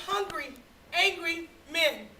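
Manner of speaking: ordinary talk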